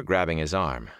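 ordinary delivery, nothing marked

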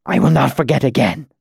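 strained, breathless promise; earnest gasp. A narrator's reading. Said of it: "he gasped out earnestly".

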